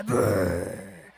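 deep rumbling noise; belly rumble. Acted. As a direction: imitate a growling stomach.

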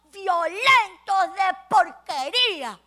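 loud scolding outburst; angry mother. Acted. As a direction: scold with disgust. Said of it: "se enfadó muchísimo y dijo".

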